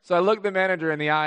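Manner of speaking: usual speaking voice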